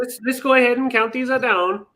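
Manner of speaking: put-on overdone Minnesota voice, sliding into another accent; failed Minnesota accent. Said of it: "that went totally Louis".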